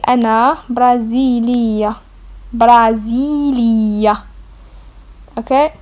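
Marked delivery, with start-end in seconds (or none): 0.0-4.2 Brasilia drawn out slowly, syllable by syllable; slow model word. Shown as a demonstration. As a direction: repeat Brasilia for the class, slowly. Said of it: "you're gonna say".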